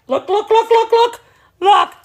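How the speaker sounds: high, loud, thrilled little dog voice; excited dog voice. Pretend. Breeder voicing her dog Moss, high and excited. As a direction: squeal it like an eager dog. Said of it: "Moss is like".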